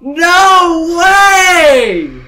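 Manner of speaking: very high, very loud triumphant screams; ecstatic shouting. Real, not acted. Gamer surviving the night, screaming in wild celebration. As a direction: yell with pure victory joy.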